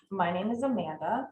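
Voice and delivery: usual speaking voice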